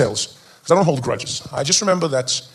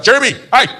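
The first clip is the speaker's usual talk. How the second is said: high-pitched telling-off; acted fatherly reprimand; mock stern dad; 6 semitones higher, 4 dB louder than usual. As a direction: call the naughty boy sharply. Friend's dad telling off his son.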